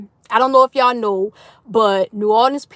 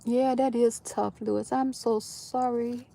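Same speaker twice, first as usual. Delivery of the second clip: hushed, gentle condolence; quiet, tender consoling; soft sympathy; 11 dB quieter than usual. Real, not acted. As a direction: say it softly, with sympathy for the listener. Consoles viewer over hard news.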